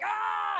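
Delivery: very high short scream; mock screaming. Woman trades screams with comic, high mock scream. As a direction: shriek it high in reply. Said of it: "we're just screaming".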